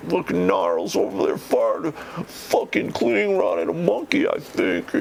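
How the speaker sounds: mocking trooper. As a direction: ham up a dopey soldier ratting someone out. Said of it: "troopers is like".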